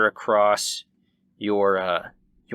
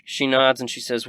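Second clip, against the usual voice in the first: normal talking voice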